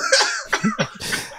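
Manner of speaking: high, airless wheeze of laughter; breathy laugh. Real, not acted. Hearing the retold quote, a high wheezing laugh.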